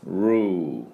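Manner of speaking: ルー as English "roo", R then a long vowel; 伸ばすだけ. Shown as a demonstration. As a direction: stretch ルー out from a pulled-back R. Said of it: "ルーって伸ばすだけです。". (ルー をRの音で長く伸ばして)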